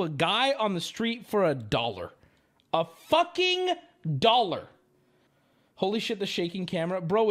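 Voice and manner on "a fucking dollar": outraged disbelief. Real, not acted. Outraged at grandpa.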